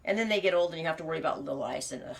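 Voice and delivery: ordinary talk, nothing marked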